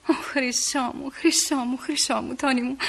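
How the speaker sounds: soft, slow, adoring murmur; tender endearments. Acted. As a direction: coo lovingly into the phone. Τρυφερά λόγια στον αγαπημένο στο τηλέφωνο. (τρυφερά, σιγανά, ερωτικά)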